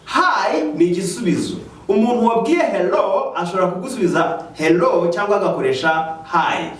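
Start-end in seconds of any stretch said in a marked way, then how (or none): none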